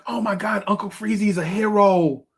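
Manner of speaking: mock crowd cheering; excited crowd praise. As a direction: hype him up as the hero. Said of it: "everybody's like".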